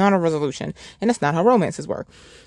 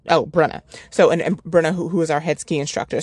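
normal talking voice

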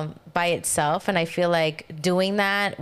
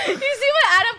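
high, loud laughing complaint; 3 dB louder than usual. Real, not acted. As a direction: complain while cracking up. Laughing at host's prank.

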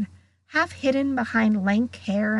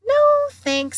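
high polite refusal; 6 dB louder than usual. Acted. Hermione refuses mouldy peanuts.

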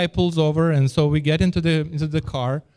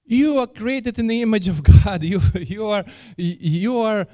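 higher-pitched indignant protest; worked-up retort in a raised pitch; upset friend; 7 semitones higher than usual. Retold or imitated. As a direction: imitate an upset believer objecting, pitch raised. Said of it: "My friend got so upset".

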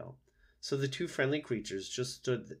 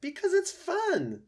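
high, drawn-out, delighted opossum; gleeful opossum; 7 dB louder, slower than usual. Acted. Opossum telling robot why it pretends, gleeful. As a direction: sing out the joy of it.

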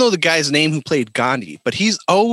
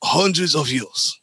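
hammed-up accented old sensei; Master Splinter; 2 semitones higher than usual. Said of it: "the only one with that accent".

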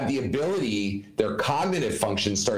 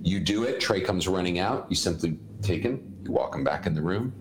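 ordinary delivery

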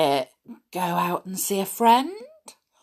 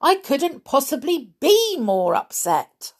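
high-pitched angry protest; 8 semitones higher, 4 dB louder than usual. A narrator's reading. Upset mouse protesting to the doctor, angry. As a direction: insist it crossly, pitched up. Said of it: "Olivia cried angrily".

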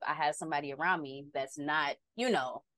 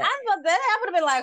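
excited; 13 semitones higher, 9 dB louder than usual. Real, not acted. Excited reaction to friend's text.

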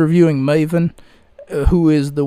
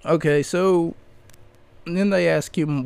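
normal talking voice

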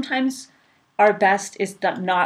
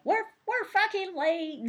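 high, slow, exasperated snap at the family; pitched-up mock panic about being late; mock frantic nagging; 12 semitones higher, 5 dB quieter, slower than usual. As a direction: ham up the stressed-out late mom. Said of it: "I would have been like".

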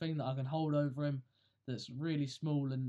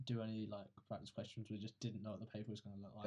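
much softer, lower voice; very quiet; 5 semitones lower, 7 dB quieter than usual. Real, not acted. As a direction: say it under your breath, barely audible. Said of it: "you speaking hella quiet".

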